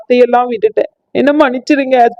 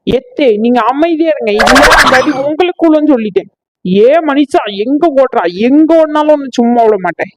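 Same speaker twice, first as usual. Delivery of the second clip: angry wife; 4 semitones higher than usual. Acted. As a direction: threaten as you chase him. Wife chasing husband.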